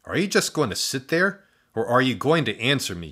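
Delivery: mild annoyed demand; a little angry. A narrator's reading. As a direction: press for an answer, irritated. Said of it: "confused and a little angry".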